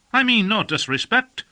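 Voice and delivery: Sam Salazar's voice. A narrator's reading. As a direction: play the cheeky youth, innocent-sounding. Sam's voice in dialogue.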